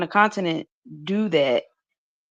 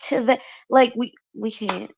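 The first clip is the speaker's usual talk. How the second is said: normal talking voice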